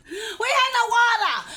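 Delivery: loud, incredulous glamping complaint; exclaimed. Real, not acted. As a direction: shout the hardship with disbelief. Exclaiming about glamping trip.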